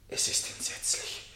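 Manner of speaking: breathy whisper of horror, barely audible; hushed, stunned whisper; horrified whisper. Acted. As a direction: whisper it as if to yourself, appalled. Suspect overwhelmed during interrogation.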